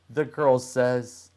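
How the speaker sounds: usual speaking voice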